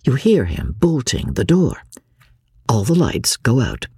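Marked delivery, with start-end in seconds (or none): none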